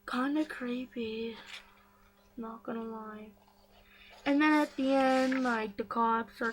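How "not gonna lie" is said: a soft whisper to the viewers; whispered aside. Real, not acted. Vlogger confiding to viewers, whispered. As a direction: admit it under your breath.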